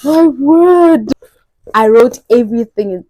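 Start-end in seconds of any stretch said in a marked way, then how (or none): 0.0-1.1 high sharp gasp then loud wonder; gasping amazement; awed gasp. Real, not acted. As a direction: gasp in awe.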